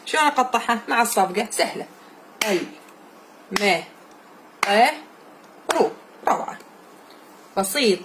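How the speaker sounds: usual speaking voice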